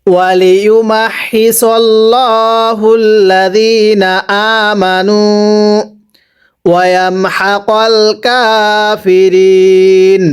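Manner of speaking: slow, measured recitation with long held vowels; Quran recitation. Shown as a demonstration. Teacher recites for students to repeat, slow Quran recitation. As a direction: chant slowly, stretching the last word কাফিরিন. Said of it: "আরো একবার পড়ব".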